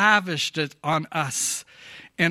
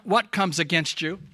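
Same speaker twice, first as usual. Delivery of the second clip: ordinary delivery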